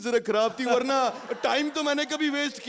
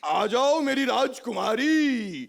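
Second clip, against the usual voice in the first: long drawn-out summons; calling out; much slower than usual. Acted. Father summoning his princess daughter, drawn-out call. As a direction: call her in, stretching the last word.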